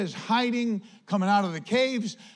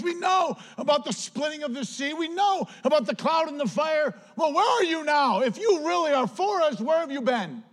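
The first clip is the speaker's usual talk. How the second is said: high, louder mock complaint, building to a shout; sourpuss Gideon; 7 semitones higher, 4 dB louder than usual. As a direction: whine at God like a sulking man who feels cheated. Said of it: "Gideon's in this sourpuss little time".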